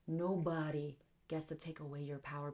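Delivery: usual speaking voice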